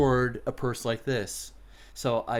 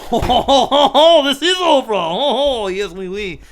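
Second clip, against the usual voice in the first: high, loud ho-ho laughing put-on voice; hammed-up chuckling store owner; jolly laughing shopkeeper; 8 semitones higher, 9 dB louder than usual. Shopkeeper chuckling as he answers Oprah, hammed up. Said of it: "he goes".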